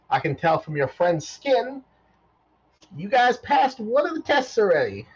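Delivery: high robot, frank and chirpy; a cheerful robot admitting danger. Acted. Robot warning the party about the chamber, high.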